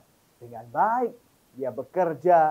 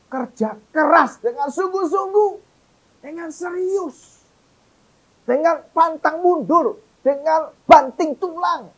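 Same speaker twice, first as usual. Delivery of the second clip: higher, louder fiery sermon; loud, rising pulpit preaching; full-force preaching; 11 semitones higher, 7 dB louder than usual. Acted. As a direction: drive it home to the congregation.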